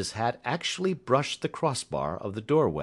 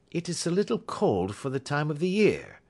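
light, higher-pitched pleasantry; unruffled small talk; 7 semitones higher than usual. Acted. Holmes ignores Roylott's question, airy and unruffled. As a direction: airily brush the question aside.

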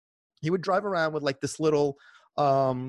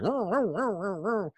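high sing-song street cry; imitating a vendor's chant; vendor call; 7 semitones higher, slower than usual. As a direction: hawker calling out. Mimics vendor's truck horn call.

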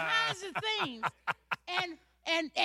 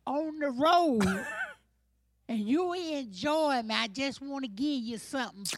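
Southern country lady; 3 semitones lower than usual. Acted.

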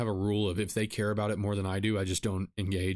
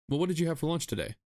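higher, faster easygoing question to his wife; husband steering the dinner talk, higher and quick; calm husband question; 6 semitones higher, faster than usual. Acted. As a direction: ask it lightly and quickly, defusing the moment.

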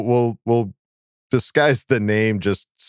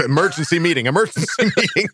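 mock panic; 6 semitones higher, faster than usual. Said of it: "panicked team management voiced".

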